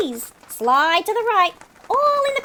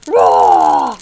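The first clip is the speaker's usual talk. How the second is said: huge, much lower, loud fake roar; mock roar; 8 dB louder than usual. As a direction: roar like a beast to scare them off. Seagull roars to scare rival.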